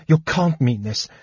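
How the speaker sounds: high, urgent, rising plea; frightened, desperate protest; terrified pleading. A narrator's reading. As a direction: beg him desperately, climbing in pitch. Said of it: "Lorana shivered with terror".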